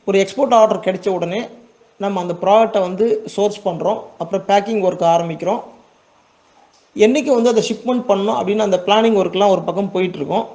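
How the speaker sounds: ordinary talk, nothing marked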